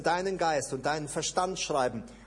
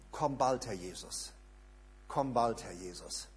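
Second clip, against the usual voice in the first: quiet, fervent prayer that drops away on the name; soft prayer; 5 semitones lower, 5 dB quieter than usual. Shown as a demonstration. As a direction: pray it softly and longingly. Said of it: "von deinem Mund kommen".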